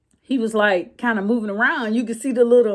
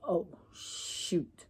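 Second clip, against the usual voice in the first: barely audible oh shoot under the breath; embarrassed mutter; 4 semitones lower, 17 dB quieter than usual. Retold or imitated. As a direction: drop it to a whispered slip. Blurting a slip, embarrassed.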